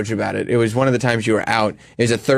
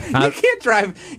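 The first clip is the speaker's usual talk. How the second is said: laughing disbelief; 13 semitones higher, much slower than usual. Real, not acted. Laughing at friend's idea.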